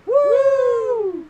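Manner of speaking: loud, high-pitched woo; excited whoop. Real, not acted. Host hyping the show's start, excited whoop. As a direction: let out a big celebratory whoop.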